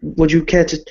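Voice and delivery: refined vampire lord greeting a guest; Viscount Alexander Harkin. Acted. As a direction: play a polite, fussy nobleman. Viscount speaking in character.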